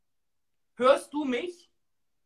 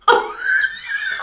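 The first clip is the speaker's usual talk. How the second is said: high shrieking laugh; 6 dB louder than usual. Real, not acted. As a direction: squeal of laughter. (kreischendes Lachen)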